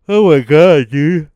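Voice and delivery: talking through a yawn; words stretched by a big yawn. Real, not acted. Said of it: "I'm sorry for all the yawning".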